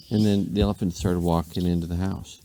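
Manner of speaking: ordinary delivery, nothing marked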